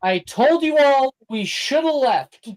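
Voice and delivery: high, exasperated told-you-so; told-you-so whine. Acted. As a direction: whine that they should have left. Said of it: "Delilah says".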